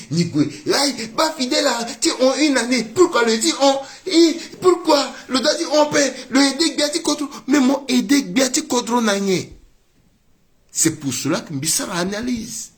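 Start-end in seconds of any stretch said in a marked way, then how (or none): 0.7-9.5 a raised, crying appeal; impassioned appeal. Acted. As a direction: cry out to the believers, voice climbing. Pleading address to fellow faithful.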